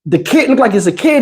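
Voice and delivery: ordinary talk, nothing marked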